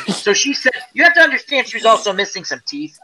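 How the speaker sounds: ordinary delivery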